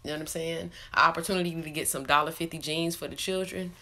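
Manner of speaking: ordinary talk, nothing marked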